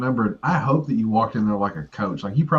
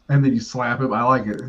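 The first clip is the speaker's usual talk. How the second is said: normal talking voice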